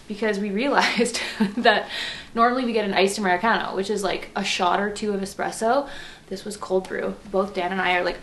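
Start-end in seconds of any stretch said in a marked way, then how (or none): none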